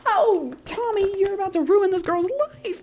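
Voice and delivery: wag a finger at him with your voice; mock scolding. Real, not acted. Warning Tommy he'll ruin her life, high scolding.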